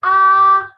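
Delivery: "Ah" held high, loud and level; first tone. Shown as a demonstration. Teacher reads the four tones, high level "Ah". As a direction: sustain "Ah" on one high note. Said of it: "read the four tones once more".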